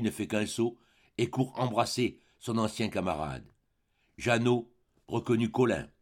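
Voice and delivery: ordinary delivery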